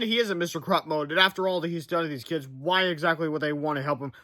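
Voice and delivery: ordinary delivery, nothing marked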